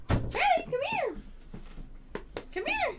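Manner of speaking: high sing-song calling the dog; talking to a dog. Real, not acted. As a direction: coax the dog over, high and sweet.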